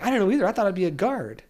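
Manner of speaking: high, loud, animated retold reply; acted-out disbelief, voice jumping high; retold surprise. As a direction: protest your own surprise, pitched well up. Acting out own surprised reply.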